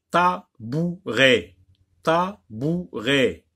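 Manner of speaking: slow, loud "Tabouret" as ta, bou, ret; split into syllables. Shown as a demonstration. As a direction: separate each beat of "Tabouret". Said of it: "Dans quelle syllabe?".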